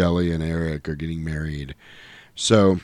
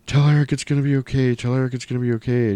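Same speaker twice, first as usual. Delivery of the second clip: dying woman's plea; 7 semitones higher, faster than usual. Retold or imitated. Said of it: "Shelley's going".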